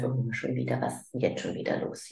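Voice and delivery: fed-up inner sigh; impatient. Retold or imitated. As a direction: mutter the tired parent's annoyance under your breath. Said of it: "Dann geh in diese Ungeduld".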